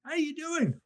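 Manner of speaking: breezy friendly hey; casual friendly greeting. Shown as a demonstration. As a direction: greet him like an old buddy. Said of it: "Why not go with".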